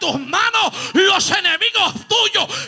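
booming preacher voice, very loud; preaching at full force. Acted. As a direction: thunder God's word at the congregation. Voicing God at full force.

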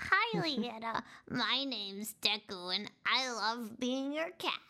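slow, cutesy cat voice; kitty cat voice. Pretend. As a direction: speak as a sweet little cat. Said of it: "They say".

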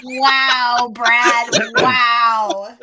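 loud, drawn-out wow; big exasperated exclamation, playfully appalled; mock outrage. Real, not acted. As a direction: loudly scold a terrible pun.

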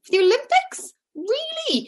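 high-pitched, disbelieving squeal of surprise; incredulous mother. Retold or imitated. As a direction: imitate an astonished mum, voice shooting way up. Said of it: "my mother couldn't believe it".

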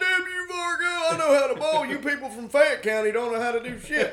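put-on high laughing drunk bluster; mock drunk boast. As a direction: ham up the cocky drunk friend. Jason boasting about bowling.

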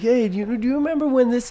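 high-pitched eager questioner; questioning movie character. Acted. Character quizzing the elephant, high questioning voice. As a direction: pitch it up, prodding for a memory. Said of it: "And he's like".

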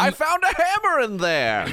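very high, loud falsetto cry; villager bit. Pretend. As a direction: call out in a hammed-up squeaky villager voice.

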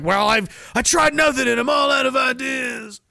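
high, slow bewildered whine; mock helpless voice. Mocking passive people out of ideas, slow high whine. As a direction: play the clueless helpless one. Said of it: "and you're like".

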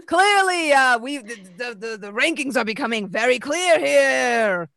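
loud, high pompous announcer. Acted. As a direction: announce it grandly, laughing through. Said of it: "the announcer says".

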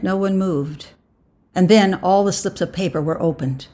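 usual speaking voice

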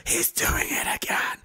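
slow whispered aside; pretend-secretive whisper; mock whisper. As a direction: whisper it slowly, as a playful aside. Mock aside about himself mid-laugh.

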